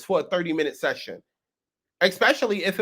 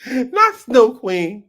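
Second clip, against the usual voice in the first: disbelief; 3 semitones higher, 8 dB louder, much slower than usual. Real, not acted. Disbelief at new post.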